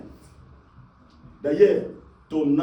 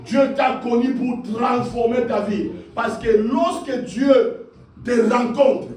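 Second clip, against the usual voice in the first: insistent, driving declaration; fervent preacher building on sustained raised phrases; prophetic preaching at full force; 8 semitones higher, 4 dB louder than usual. Real, not acted. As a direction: proclaim each promise over the congregation.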